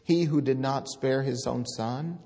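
declaiming God's words from the pulpit; scripture proclaimed. Acted. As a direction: thunder the prophet's words to the congregation. Quotes Romans loudly.

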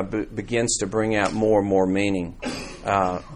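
normal talking voice

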